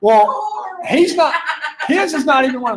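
high-pitched, breaking up with laughter; laughing while talking. Real, not acted. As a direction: squeeze the words out through laughter. Laughing at an example of bad accents.

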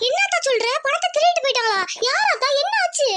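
high-pitched, alarmed questions; shocked questioning. Acted. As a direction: fire out questions in panic. Daughter hears of the robbery. (அதிர்ச்சியுடன் பதறிக் கேட்பது)

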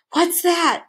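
high, startled question; gasped question. A narrator's reading. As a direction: gasp it out in alarm. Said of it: "gasped Milo".

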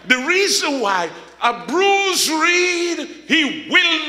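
high, stretched sermon shout; full-force preaching. Real, not acted. Preacher assuring the congregation God won't break them, full-force preaching. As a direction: drive it home with long, lifted key words.

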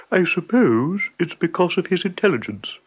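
unsure stammer in a buffalo voice; hesitant buffalo. A narrator's reading. Buffalo unsure, answering Tiger with a stammer.

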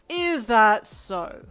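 loud, very slow scornful question; scoffing. A narrator's reading. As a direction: draw it out with contempt. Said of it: "Kuruno scoffed back".

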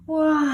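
high breathy yawn. Acted. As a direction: yawn big and sleepy. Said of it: "позіхає причіп". (позіхання)

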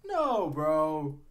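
high, loud repeated no; loud disgusted refusal. Real, not acted. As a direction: loudly refuse the food. Reacting to raw chicken.

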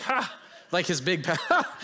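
a sudden high mock "Ha!"; imitated big laugh. Retelling the pastor laughing at his stress. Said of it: "he actually laughed at me".

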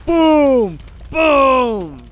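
loud, high triumphant "Boom!"; excited shout. Real, not acted. Gamer yelling at cannon hit, loud high shout. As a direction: yell it as the blast lands.

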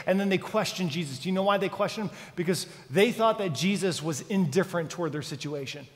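ordinary talk, nothing marked

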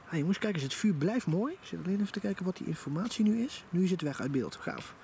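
ordinary delivery

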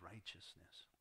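a breathy whisper on the last word. Real, not acted. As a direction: let it fall to a whisper. Preacher drops to close point.

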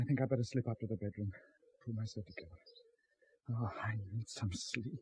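worn-out murmur to himself; exhausted mumble. Acted. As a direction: trail off to yourself, drained. Shaken man withdraws to bed.

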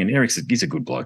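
normal talking voice

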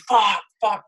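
high, loud panicked curse; startled yell of fear in the dark; alarmed swearing. Retold or imitated. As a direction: blurt it out in sudden fright. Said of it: "I'm like".

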